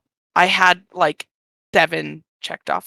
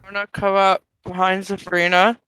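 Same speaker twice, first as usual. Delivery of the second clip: normal talking voice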